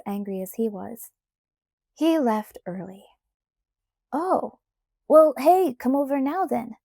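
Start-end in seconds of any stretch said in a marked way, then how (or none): 4.1-6.8 higher and louder, bursting with eagerness; suddenly keen, jump at the idea; sudden enthusiasm. Acted. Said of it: "with sudden enthusiasm".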